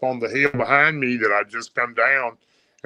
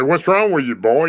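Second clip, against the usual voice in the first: lightly voiced old granddad, a bit louder; raised old man calling out; 4 semitones higher, 5 dB louder than usual. Granddad voiced in retold story.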